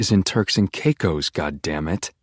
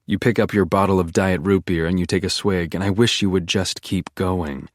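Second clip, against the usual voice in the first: ordinary delivery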